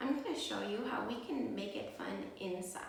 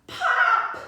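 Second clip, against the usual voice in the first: loud; 18 dB louder than usual. Acted. Balloon bursts in story.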